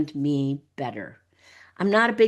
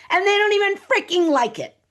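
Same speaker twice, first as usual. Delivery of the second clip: high, louder exasperated outburst; 12 semitones higher, 4 dB louder than usual. Real, not acted. Telling women listeners off, bursting out high and exasperated. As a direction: burst out in disbelief.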